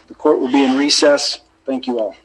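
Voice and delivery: ordinary talk, nothing marked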